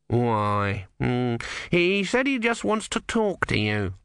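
a hint of the fussy deacon; old cleric voice. Numrod answers the novice.

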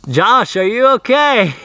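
calling loudly across the woods. Real, not acted. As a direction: shout his name to find him.

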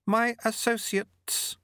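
high, slow and haughty; lightly voiced, haughty lady. A narrator's reading. Lady Thep speaks.

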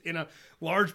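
normal talking voice

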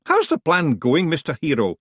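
usual speaking voice

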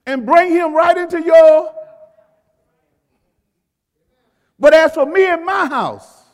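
preaching at full force. Acted. Preacher urges parents in church, rising full-force preaching. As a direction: drive it home to the congregation.

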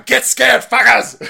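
loud, high taunting yell; mock shout. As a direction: bellow it like a scaremonger. Mocking the fearmongers.

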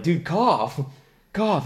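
high-pitched mock urging. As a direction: imitate worried buddies prodding him. Acting friends urging him to cough.

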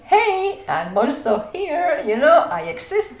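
loud, high mock call for attention; playful, hammed-up "notice me" shout; trying to get noticed. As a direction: call out as if waving to be seen. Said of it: "trying to be in their visuals".

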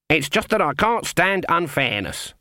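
put-on high, indignant old witch; indignant Granny. A narrator's reading. Granny denying she takes offence at criticism, indignant.

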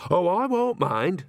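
airy, high-pitched brush-off; breezily unbothered old woman; dismissive. A narrator's reading. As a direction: wave the worry away, drawing it out. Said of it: "said Nanny dismissively".

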